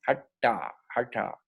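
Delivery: "hatha" with the tongue curled up for the t; retroflex T. Shown as a demonstration. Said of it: "una T retrofleja".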